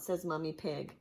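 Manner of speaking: usual speaking voice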